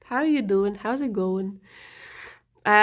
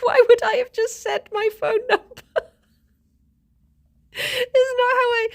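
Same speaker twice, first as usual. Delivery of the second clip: high-pitched protest through laughter; incredulous retelling, helpless with giggles; laughing indignation; 12 semitones higher than usual. Retold or imitated. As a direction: squeak out the retort while cracking up. Laughs while retelling her indignant reply.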